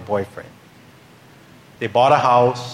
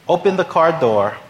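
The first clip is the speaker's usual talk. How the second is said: ordinary delivery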